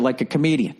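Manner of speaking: ordinary delivery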